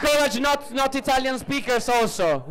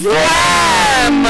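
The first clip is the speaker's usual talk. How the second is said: loud excited shout; 5 semitones higher, 10 dB louder than usual. Real, not acted. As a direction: shout it out to the crowd. Host calls out the event start.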